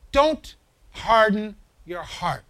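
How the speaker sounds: "don't harden your heart" read slowly and evenly; slow, measured reading. Acted. Preacher slowly reading the verse to the congregation, measured. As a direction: draw out every word of the verse.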